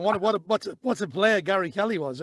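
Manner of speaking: ordinary delivery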